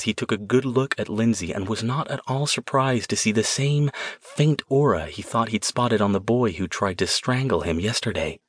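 usual speaking voice

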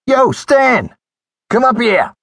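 high, loud holler; calling down the stairs. A narrator's reading. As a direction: holler through cupped hands to someone below. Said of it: "cupped his hands around his mouth and called".

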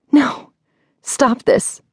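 high, alarmed plea; frightened protest. A narrator's reading. Woman stopping the crystal ball taunt, frightened. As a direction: cut in high, wanting it stopped.

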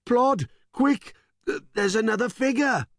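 high, fast frantic cry; panicked. Acted. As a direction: panic at the sight. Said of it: "in a tizzy".

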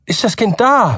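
high, breathless question; anxious father. A narrator's reading. Nervous Button asks the doctor about the baby, high and anxious. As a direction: ask it nervously. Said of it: "fragte Mister Button".

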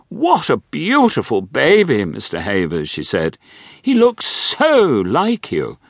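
a lightly hammed grand dame; wealthy widow. A narrator's reading. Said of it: "said the dowager".

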